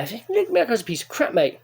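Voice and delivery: usual speaking voice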